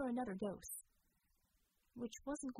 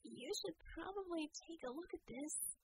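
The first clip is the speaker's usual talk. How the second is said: put-on a higher, softer young woman, a touch surprised; Harmony's voice; 7 semitones higher, 4 dB quieter than usual. Harmony calling the team to see what she found, high and soft. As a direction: call the others over, lifted and hushed. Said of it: "Harmony's surprised squeal".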